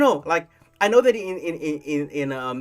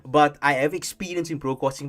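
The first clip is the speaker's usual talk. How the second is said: normal talking voice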